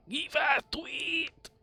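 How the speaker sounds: high, slow goofy voice; silly high voice. Shown as a demonstration. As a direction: stretch it out in a squeaky voice. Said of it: "Instead of give it".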